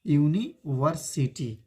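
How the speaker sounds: slow, careful university with a clear yu onset; teacher's deliberate university said syllable by syllable; slow model pronunciation. Shown as a demonstration. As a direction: spell out university slowly for the class. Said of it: "इसको pronunciation देखिए".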